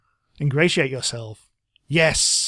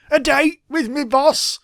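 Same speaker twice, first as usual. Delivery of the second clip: high, drawn-out put-on voice; put-on character voice; 10 semitones higher, slower than usual. Game crewman answering a flirtation, high put-on voice. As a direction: say it slowly in a high, silly character voice. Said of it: "That's his voice now".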